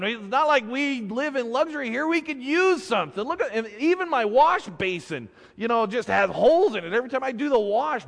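high, loud exasperated nagging; scolding wife. Acted. Wife scolds fisherman for asking nothing, high, indignant rant. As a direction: berate him for wasting the chance.

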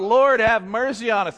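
high, loud plea called from afar; calling out. Retold or imitated. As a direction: call out for mercy across a distance. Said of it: "and they call out".

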